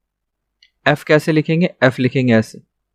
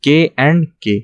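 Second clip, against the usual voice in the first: slow spelling out; much slower than usual. Shown as a demonstration. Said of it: "पहला दो consonant".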